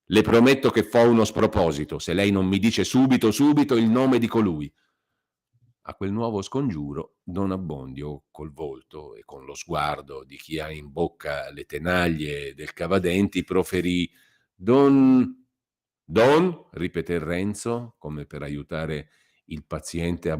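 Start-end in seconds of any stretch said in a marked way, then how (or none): 0.1-4.7 Renzo rushing, loud and menacing; fast angry threat. A narrator's reading. 14.5-16.7 louder, higher, stuck on one syllable; strained hesitation. A narrator's reading. Curate forced to name.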